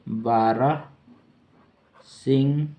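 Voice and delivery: बारह, then a long pause, then Singha; slow dictation. Shown as a demonstration. Teacher dictating the meaning of stag, part by part. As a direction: split बारह Singha into drawn-out parts. Dictates in parts while writing.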